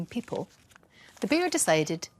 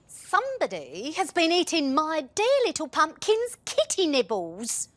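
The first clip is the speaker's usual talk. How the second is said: higher fussing mum voice; fretting mother; 8 semitones higher, 3 dB louder than usual. A narrator's reading. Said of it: "said the mummy person".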